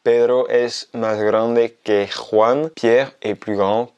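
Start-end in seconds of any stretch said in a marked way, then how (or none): 0.0-2.7 very slow, clear repetition; slow repeat. Shown as a demonstration. Teacher repeating the example very slowly for learners. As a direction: say it again even slower. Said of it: "je vais donner un exemple".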